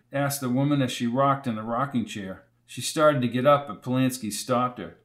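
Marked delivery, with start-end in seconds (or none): none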